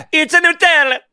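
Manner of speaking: loud, very high cartoon Italian; Italian accent. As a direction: shout it as a caricature Italian. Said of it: "do an Italian accent".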